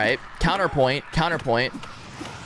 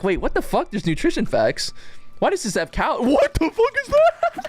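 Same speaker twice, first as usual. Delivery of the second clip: mock shock; 10 semitones higher than usual. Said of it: "you're like".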